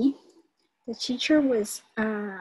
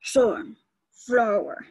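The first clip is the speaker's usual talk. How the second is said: slow, split robot word; drawn-out robot puppet word; 5 dB louder, much slower than usual. Shown as a demonstration. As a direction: stretch it out like a stiff robot. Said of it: "the robot to say the two words separately".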